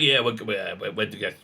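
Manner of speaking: low, soft, faltering give-up; mock defeat. Voicing Democrats who have given up, in mock defeat. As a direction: imitate someone trailing into defeat. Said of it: "they just kind of given up".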